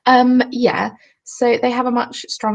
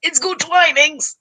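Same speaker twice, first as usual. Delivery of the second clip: "Twinings" raised high with a long i, twine-ings; emphatic correction; 7 semitones higher than usual. Shown as a demonstration. Correcting a viewer's mispronunciation of the brand, firm and raised. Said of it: "It's only got one N".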